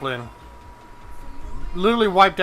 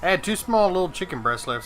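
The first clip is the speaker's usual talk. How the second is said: usual speaking voice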